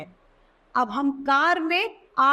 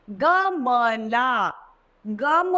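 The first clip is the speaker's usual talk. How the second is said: गमला stretched slowly, each syllable apart; slow syllable reading; much slower than usual. Shown as a demonstration. Teacher reading गमला slowly to the class. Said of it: "एक गमला दिया गया है".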